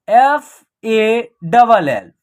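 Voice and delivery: "एस ए डी" letter by letter, slow; spelled out slowly. Shown as a demonstration. Teacher spells the example letter by letter, slowly. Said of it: "इसका उच्चारण होगा".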